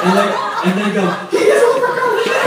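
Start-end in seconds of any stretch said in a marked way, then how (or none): 1.3-2.5 very high, loud squeal of discovery; hammed-up amazement. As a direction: imitate an astonished believer shrieking. Acted amazed discovery by the three.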